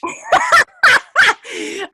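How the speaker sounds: very high, loud burst of laughter; real delighted squeal of laughter; shrieking laugh. Real, not acted.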